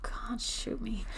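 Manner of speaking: slow, breathy whisper of disgust; whispered despair. Real, not acted. Reacting to the messages, in a slow disgusted whisper.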